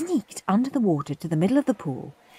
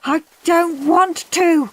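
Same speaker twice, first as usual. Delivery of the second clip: scared whine; 10 semitones higher, 3 dB louder, slower than usual. A narrator's reading. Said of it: "said Andrew".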